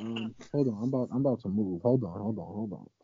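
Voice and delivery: smothered, like through fabric; muffled through clothes. Real, not acted. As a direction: voice buried under clothes. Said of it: "sound like he moving through clothes".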